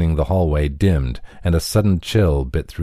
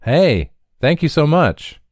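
a grateful shout, much higher and a bit louder; shouted thanks; 10 semitones higher, 3 dB louder than usual. A narrator's reading. Juan calling thanks down the hallway, loud and grateful. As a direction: call out after him, overjoyed. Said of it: "and shouted".

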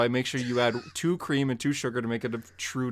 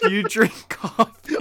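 higher, slow and louder; 7 semitones higher, 5 dB louder, slower than usual. Acted. Asking Harper again about coffee, slow, higher and louder. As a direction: drag the question out in a put-on high voice. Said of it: "Why do you have to say it like that".

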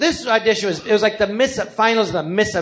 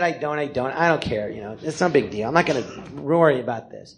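lower, softer, shrugging it off; casual Damon quote; 5 semitones lower, 4 dB quieter, faster than usual. Retold or imitated. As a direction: play it cool, no big deal. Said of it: "He goes".

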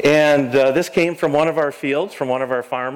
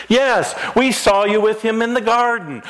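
higher, pressing accusation; accuser's challenge; 10 semitones higher than usual. Acted. Servants accusing Peter in the courtyard, pitched-up pressing voice. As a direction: push the charge at him, pitched up.

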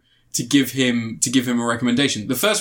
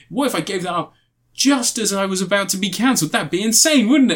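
higher-pitched, worked-up retold disbelief; mock incredulous plea; 6 semitones higher than usual. Host imagines his show being cancelled, mock incredulous. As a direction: act out mildly hysterical disbelief, voice rising.

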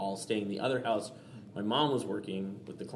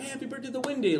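sudden high, playful shout-out; Robin Williams impression; 13 semitones higher than usual. Robin Williams calling out a birthday on set, high and playful. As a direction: launch it up high like a manic host. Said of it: "my Rob Williams impersonation".

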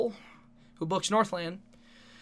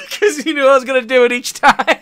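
laughing; 7 semitones higher, 15 dB louder than usual. Real, not acted. Laughing while explaining.

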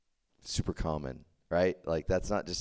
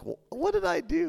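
much higher, innocent bewildered squeak; clueless dad. Retold or imitated. Uncle confused by his daughter's embarrassment, high bewildered question. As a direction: play the baffled dad who did nothing wrong. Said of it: "He'll be like".

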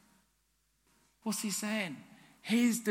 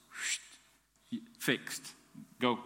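whispered whoosh; 6 semitones lower, 7 dB quieter, much slower than usual. Pretend. Imagined magic wand fix.

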